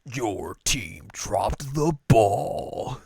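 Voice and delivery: very slow announcer call; drawn-out game announcer; Halo announcer. Retold or imitated. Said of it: "see if the announcer goes".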